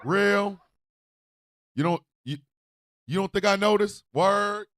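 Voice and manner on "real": hyped affirmation. Pretend. To the crowd, loud mock street affirmation. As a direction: call it out like a hype man.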